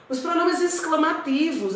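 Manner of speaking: normal talking voice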